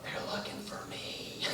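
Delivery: hushed, voiceless punch line; child's whisper. Acted. Hiding kid confesses to the caller, whispering.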